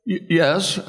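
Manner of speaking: high-pitched, stammering nerves; shaking nervous. Retold or imitated. Nervous castmate answering Norm's challenge, shaking. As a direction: mimic a rattled actor stammering. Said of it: "now he's shaking".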